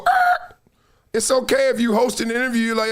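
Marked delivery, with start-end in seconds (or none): 0.1-0.5 high, loud startled "Er" noise; mock awkward grunt. Host imitating Marcellus's awkward grunt, high and loud. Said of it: "in the Sway interview go".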